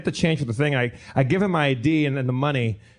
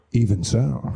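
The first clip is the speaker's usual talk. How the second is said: low, ominous retold reply; leaning in low; 4 semitones lower, slower than usual. Store clerk insisting to the customer, leaning in low. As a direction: drop the pitch and lean into the words. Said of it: "he leaned in and got low".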